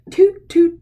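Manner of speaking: loud, high toot like a tuba; tooting tuba noise. A narrator's reading. Reading to kids, imitating the elephant band's tubas. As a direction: blow it out like a brass horn.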